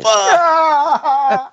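high, loud startled yell; retold scream. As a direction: shriek it in mock horror.

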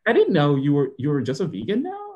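normal talking voice

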